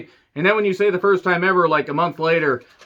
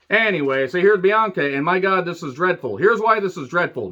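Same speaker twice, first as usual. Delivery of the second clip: ordinary talk, nothing marked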